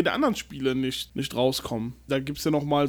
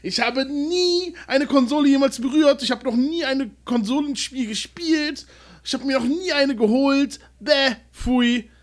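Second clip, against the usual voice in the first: higher, disdainful console hater; snobby PC gamer; 7 semitones higher than usual. Retold or imitated. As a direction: sneer like a PC purist. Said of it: "die sagen".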